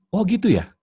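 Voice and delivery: high-pitched acknowledging reply; responsive husband. Shown as a demonstration. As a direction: show hammed-up interest in her story. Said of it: "Jawab dengan tiga kata".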